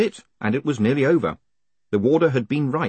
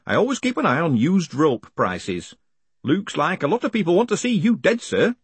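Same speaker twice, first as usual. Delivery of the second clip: higher, animated hangman voice; chatty hangman; 8 semitones higher, 4 dB louder than usual. Acted. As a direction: chat cheerfully to the condemned man.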